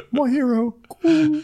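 very high squeaky bird; pigeon voice. Pretend. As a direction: salute her as a dopey pigeon. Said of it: "saluting her like".

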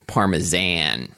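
Parmesan drawn out slowly, ending in a flat "zan"; exaggerated American pronunciation. Shown as a demonstration. Co-host echoing the American way, Parmesan slow with -zan. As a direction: stretch "Parmesan" into par-muh-ZAAN.

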